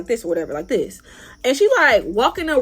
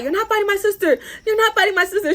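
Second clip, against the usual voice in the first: high-pitched mimic of a nervous girl; imitated cracking voice; 9 semitones higher, faster than usual. Girl circling, warding off a fight for her sister, imitated cracking voice. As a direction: imitate her protesting in a much higher voice. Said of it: "sound like her voice is cracking".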